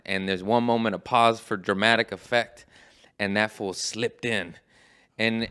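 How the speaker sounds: ordinary delivery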